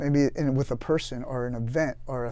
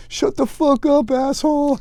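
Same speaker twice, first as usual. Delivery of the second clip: mock yell at sergeant; 12 semitones higher, 7 dB louder than usual. Coots yelling back at the staff sergeant, loud high insult. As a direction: blast the screaming sergeant. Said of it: "Coots is like".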